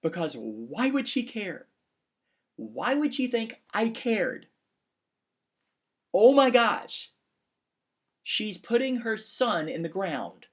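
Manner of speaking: fighting back real tears as he speaks; choked up, real tears. Real, not acted. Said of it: "And I began to cry".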